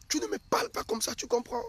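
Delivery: high-pitched acted scolding; indignant wife. Acts wife scolding her husband.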